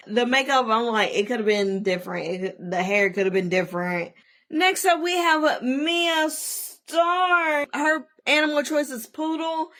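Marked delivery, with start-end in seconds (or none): 4.5-9.7 high, slow, stretched-out introduction; runway announcer. Pretend. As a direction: present the contestant, drawing out the name. Introduces the next runway contestant.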